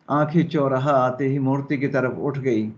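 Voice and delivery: normal talking voice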